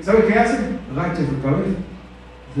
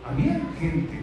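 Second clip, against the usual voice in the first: usual speaking voice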